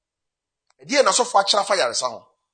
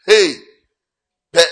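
ordinary delivery

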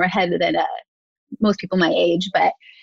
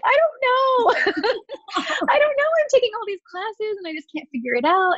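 high-pitched, half-laughing mock helplessness; high, comically lost reenactment; flustered helplessness; 13 semitones higher than usual. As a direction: imitate yourself flustered, voice pitched way up. Retold helpless answer to friend.

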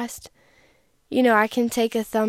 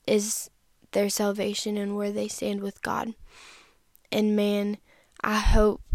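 ordinary talk, nothing marked

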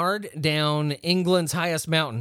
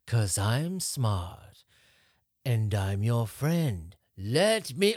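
much lower, very slow sinister croon; creepy old priest; 6 semitones lower, much slower than usual. Retold or imitated. Podcaster mimicking the film's scary priest, low and sinister. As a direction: drag each word out like a menacing old preacher.

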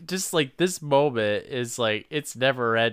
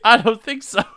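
put-on indignant boyfriend; 9 semitones higher, 9 dB louder than usual. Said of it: "And he's like".

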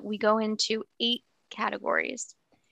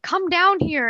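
calling down; 9 semitones higher, 4 dB louder than usual. Retold or imitated. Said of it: "Indiana's like".

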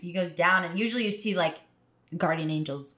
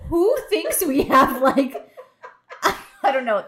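laughing; 7 semitones higher, 7 dB louder, slower than usual. Real, not acted. Laughing incredulous rant.

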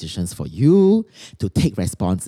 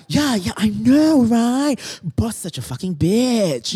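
much higher, slow, mock-sympathetic gush; sarcastic agreement; 9 semitones higher, slower than usual. Coach mocking a client's rant about the boss, sarcastic. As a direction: fake full agreement, dripping sarcasm. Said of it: "because being very sarcastic".

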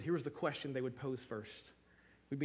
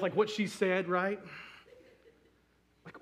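ordinary talk, nothing marked

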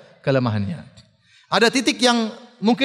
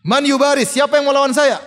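high, raised war-challenge cry; shouted challenge; 7 semitones higher, 4 dB louder than usual. Retold or imitated. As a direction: call out a challenge across the battlefield. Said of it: "dia berteriak di hadapan kaum muslimin".

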